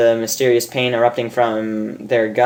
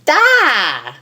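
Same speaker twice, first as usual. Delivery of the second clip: put-on baby babble; 11 semitones higher than usual. Baby shouting da at Rainbow, high babble. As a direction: blurt it like a toddler.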